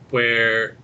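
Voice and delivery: ordinary talk, nothing marked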